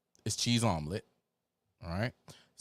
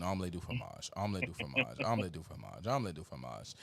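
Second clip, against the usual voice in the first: stuck record; 4 semitones lower, 6 dB quieter, faster than usual. Retold or imitated. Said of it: "it kept repeating".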